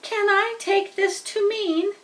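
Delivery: put-on hopeful young pig asking; excited pig. Wilbur asks the spiders if they will stay, high and eager.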